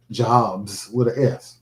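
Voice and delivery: slow and deliberate, leaning on "Jobs"; slow emphatic correction; stress on plural. Shown as a demonstration. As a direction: spell out "Jobs with an S" slowly. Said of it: "my day job".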